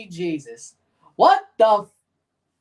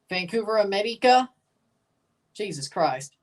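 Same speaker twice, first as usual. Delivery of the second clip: usual speaking voice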